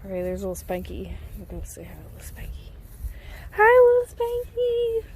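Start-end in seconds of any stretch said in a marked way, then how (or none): 1.4-2.7 fast hushed whisper. Real, not acted. Telling herself she'll greet Spanky, whispering. 3.5-5.0 high, slow cooing greeting. Real, not acted. Greeting the little pony in high baby talk.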